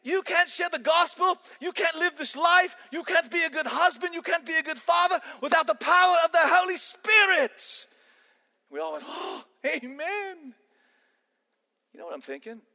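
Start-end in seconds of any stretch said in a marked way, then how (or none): 0.0-7.9 high, loud, fiery Scottish professor; fired-up Scottish roar, building to the end; Scottish accent. Acted. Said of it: "American accent to my Scottish accent". 9.1-10.5 high, long drawn-out amen; mock-awed, slow and high; awed assent. Class's awed reply, acted out.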